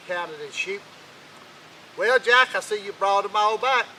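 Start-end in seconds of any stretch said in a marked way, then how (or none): 2.0-3.9 higher and louder, grudging; gruff old king. Acted. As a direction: call out to him like a sour old boss. King greets Jack, counting sheep.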